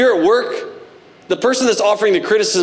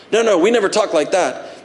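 ordinary talk, nothing marked

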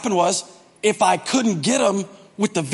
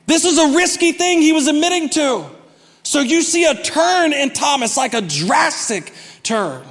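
usual speaking voice

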